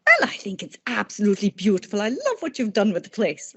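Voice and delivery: lightly voiced Irish mother-in-law pleased with the house; light Irish accent. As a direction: fondly imitate a thick Irish mother-in-law's voice. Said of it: "in her thick Irish accent".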